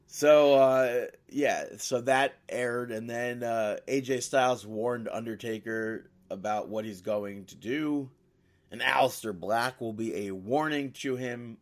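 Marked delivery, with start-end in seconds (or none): none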